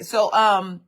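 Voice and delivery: loud, loose street-casual take; casual homegirl voice. Shown as a demonstration. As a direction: talk to her like your friends would. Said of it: "the way I talk to y'all".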